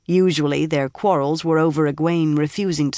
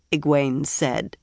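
plain reading voice

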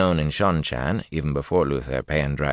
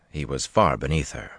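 ordinary delivery

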